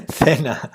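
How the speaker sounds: "Sena" with a lisped th, THEH-na; alternative pronunciation. Shown as a demonstration. Streamer trying a viewer's name again, lisped pronunciation. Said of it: "try to say it with Spanish spelling".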